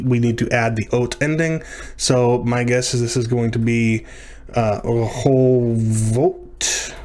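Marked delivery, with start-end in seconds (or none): 4.8-7.0 very slow, drawn-out "rehovot"; sounding it out. Real, not acted. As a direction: stretch "rehovot" syllable by syllable. Working out a plural aloud.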